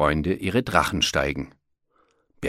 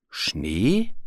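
puzzled question with a rising end; astonished. A narrator's reading. Bear who has never heard of snow, astonished. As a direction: wonder at an unknown word. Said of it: "Berti staunte".

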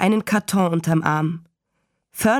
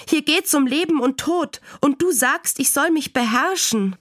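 put-on high-pitched child's indignant protest; empörtes Schreien; 7 semitones higher than usual. A narrator's reading. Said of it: "schrie Fern". (kindlich aufgebracht, mit hoher Stimme)

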